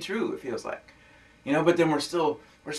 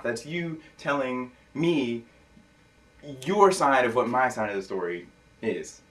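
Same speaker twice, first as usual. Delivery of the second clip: ordinary talk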